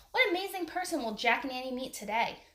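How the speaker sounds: plain reading voice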